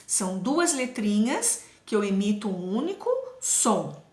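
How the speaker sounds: usual speaking voice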